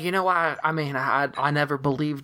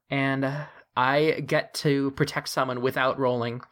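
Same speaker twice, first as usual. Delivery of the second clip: usual speaking voice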